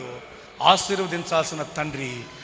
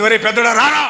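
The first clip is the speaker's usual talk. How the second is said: loud call across the yard; 5 semitones higher, 6 dB louder than usual. Retold or imitated. Aunt calling her nephew to come, loud call. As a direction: shout for the nephew to come help.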